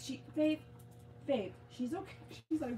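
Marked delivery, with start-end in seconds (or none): none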